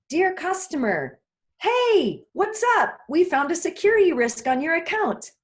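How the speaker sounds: chirpy, way too cheerful for bad news; too happy. Shown as a demonstration. Warning a customer about a security risk, far too cheerful. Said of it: "That's the tone is too happy".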